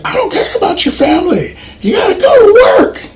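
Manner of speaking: hammed-up high-pitched yell at the workers; mock angry yelling. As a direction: squeal it like a shouting tough guy. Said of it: "he's like".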